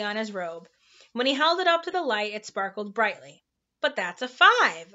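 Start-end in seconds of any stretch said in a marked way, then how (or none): 3.8-4.9 a louder, protesting objection; objecting. A narrator's reading. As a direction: push back, a touch indignant. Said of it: "objected Milo".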